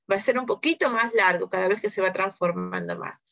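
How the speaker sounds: ordinary talk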